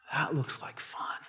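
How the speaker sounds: whispered temptation. Retold or imitated. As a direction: hush it like a secret lure. Tempted friend voiced in aside.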